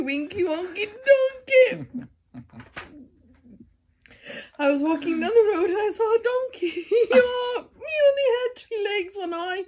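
high, teary words through laughter; real laughing fit; laughing while reading. Real, not acted.

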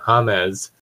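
"James" said as Hah-mes, Spanish style. Shown as a demonstration. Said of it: "I forgot about Spanish".

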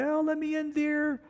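high-pitched mock drunken call up to the window; lightly hammed drunk calling upstairs to his wife; drunk husband bellowing. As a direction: ham up a drunk pleading to be let in. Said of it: "he'd bellow upstairs to her window".

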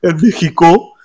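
high, drawn "En México" in his voice; a hint of Miguel's ending; Miguel's tag. Shown as a demonstration. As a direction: mimic his sign-off "En México". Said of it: "cuando acaba de".

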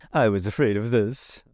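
plain reading voice

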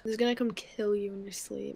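ordinary talk, nothing marked

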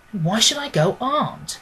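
ordinary delivery, nothing marked

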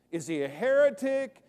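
ordinary talk

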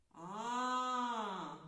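А held long and clear for the class; drawn-out vowel. Shown as a demonstration. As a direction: stretch the vowel А slowly. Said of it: "Який перший звук?".

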